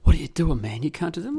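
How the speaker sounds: loud, incredulous challenge; mock indignant onlookers. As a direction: imitate the crowd's outraged "what are you doing". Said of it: "everyone's looking at me like".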